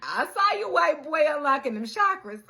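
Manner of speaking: high and loud, teasing call-out; excited call-out. Real, not acted. Vlogger calling out the chakra guy, excited and teasing. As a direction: shout him out with glee.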